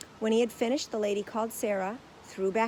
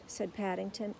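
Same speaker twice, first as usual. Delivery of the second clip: ordinary delivery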